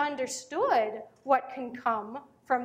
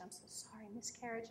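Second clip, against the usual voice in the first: very hushed, retold condolence; softly imitating a saddened stranger; sunken sympathy; 17 dB quieter than usual. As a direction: let the voice sink almost to nothing. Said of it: "she just sort of kind of sunk".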